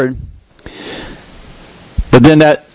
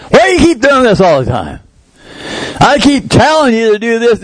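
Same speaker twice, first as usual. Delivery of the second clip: mock scream; 8 semitones higher than usual. Preacher imitating someone scolding a loved one, mock yell. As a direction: play the frustrated nagging parent. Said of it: "We can scream at people".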